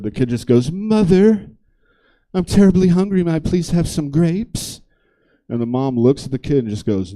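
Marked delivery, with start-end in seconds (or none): 0.7-4.8 put-on high, prim little child voice; well-spoken kid. Well-spoken kid asking mother for grapes, polite child voice. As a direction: play a politely pleading, articulate kid. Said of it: "most well-spoken kid I've ever heard".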